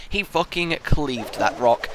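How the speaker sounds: ordinary delivery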